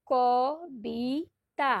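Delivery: ক, বি, তা each said alone with pauses; syllables apart. Shown as a demonstration. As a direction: break কবিতা into its three syllables. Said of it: "আলাদা আলাদা উচ্চারণ করছি দেখো". (অক্ষর আলাদা করে বলা)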